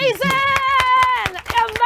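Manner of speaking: high, very loud, drawn-out acclaim; excited cheer. Real, not acted. Host applauds a child's five repeats, excited cheer. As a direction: shout it out in delight, stretching it.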